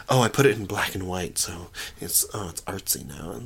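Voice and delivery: quick, soft, throwaway mockery; mock artsy filmmaker. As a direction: toss it off under your breath as a self-satisfied director. Mocks an artsy filmmaker's excuses.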